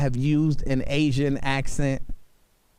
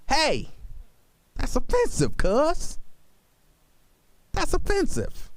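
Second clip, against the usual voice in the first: high-pitched, protesting call-out; mock objection; 11 semitones higher than usual. Host voicing what he wishes he could say, mock objection. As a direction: object in a much higher voice.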